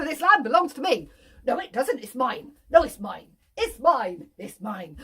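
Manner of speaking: put-on loud childish back-and-forth; two farmers bickering over a meadow, cross; squabbling farmers. Two farmers quarrel over land.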